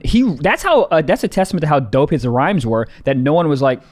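normal talking voice

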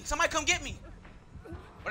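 normal talking voice

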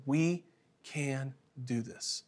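calm reassurance, almost under the breath; simple and gentle. Retold or imitated. Father kneeling beside struggling son, gentle reassurance. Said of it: "real simple".

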